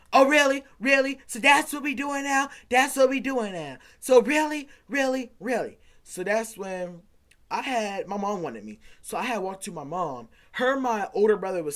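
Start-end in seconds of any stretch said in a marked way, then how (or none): 0.1-5.8 high, loud mock indignation; mocking the girlfriend. Teen mimics his girlfriend's outraged reply, high and loud. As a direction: parrot her offended "really" back, hammed up.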